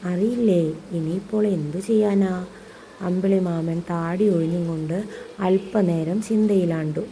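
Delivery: animated storytelling with acted dialogue; story narration. A narrator's reading. (ഭാവത്തോടെയുള്ള കഥ വായന)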